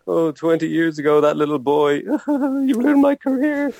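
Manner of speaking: badly faked wailing, breaking into laughter; crying teacher. Imagining the old teacher at home, mock crying. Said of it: "She's at home crying now".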